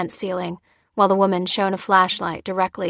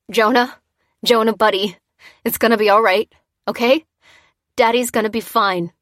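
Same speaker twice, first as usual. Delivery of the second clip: put-on loud forced reassurance to child; reassuring; 2 semitones higher, 5 dB louder than usual. A narrator's reading. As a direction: insist it'll be fine. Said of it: "he insisted".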